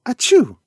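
small high sneeze; a loud little sneeze. A narrator's reading. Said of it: "they heard a small".

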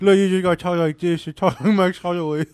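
Max Holloway voice. Retold or imitated. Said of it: "he's gonna blow you a kiss".

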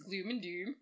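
"Gloom and doom" with rounded umlaut u vowels; umlaut u sound. Shown as a demonstration. Co-host demonstrating the umlaut accent, "Gloom and doom" with ü vowels. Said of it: "U's with umlauts".

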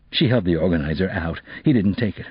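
ordinary delivery

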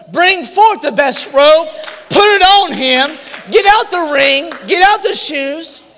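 loud, slow, ringing orders to the servants; father's joyful command. Acted. Father ordering his servants to dress his son, loud and slow. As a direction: call it out high and loud like a glad father.

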